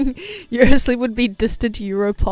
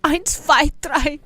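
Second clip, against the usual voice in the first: higher, hammed-up German club guy; mock German accent; 6 semitones higher than usual. Streamer hamming up German phrases, playful accent. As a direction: play a German partygoer, lightly. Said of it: "wake up with an accent".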